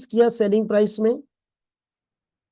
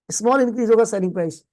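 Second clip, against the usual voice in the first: quick recap; faster than usual. Shown as a demonstration. Teacher repeats the line quickly.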